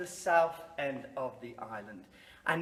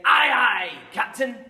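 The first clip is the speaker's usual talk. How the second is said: loud higher call of aye aye Captain; shouted salute; 5 semitones higher, 10 dB louder than usual. Shown as a demonstration. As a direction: bark it back like a sailor. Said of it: "you're going to say".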